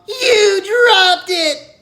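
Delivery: very high, very loud drawn-out yell; boy screaming at Zinkoff for dropping it; furious scream. A narrator's reading. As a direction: scream the blame at him. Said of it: "he screams at Zinkoff".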